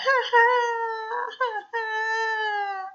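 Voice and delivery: high hammed-up cub's wail; mock crying. Little bear starting to cry, playful mock sob. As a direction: fake a little bear's boo-hoo. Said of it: "comenzó a llorar".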